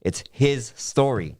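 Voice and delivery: "his" slow and leaned on; slow, pointed "it's his story"; stress on his. Shown as a demonstration. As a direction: split "his story" apart, slowly. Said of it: "Like, nah".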